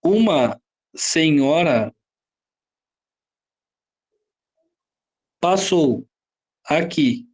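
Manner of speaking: slow, spaced example sentence; slow example read. Shown as a demonstration. Teacher reading an example to students, slow and spaced. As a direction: lay out each word slowly for the class. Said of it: "se eu falar assim, ó".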